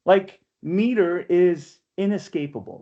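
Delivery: normal talking voice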